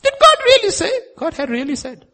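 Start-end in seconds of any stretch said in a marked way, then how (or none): 0.0-0.9 very high, loud mocking question; devil's questioning. Devil sowing doubt about what God said, high mocking voice. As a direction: squeal it as a loud, doubting challenge. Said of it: "question of the devil".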